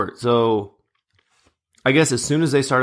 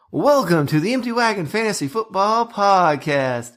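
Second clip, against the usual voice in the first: slow, higher-pitched announcer-style intro; podcast intro imitation; 7 semitones higher than usual. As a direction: draw out the show's opening, pitched up. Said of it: "And I hear".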